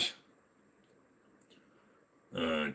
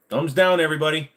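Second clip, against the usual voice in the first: higher, louder hype; rally the chat playfully; hyped mock demand; 9 semitones higher, 6 dB louder than usual. Streamer urging everybody to dislike the video, hyped.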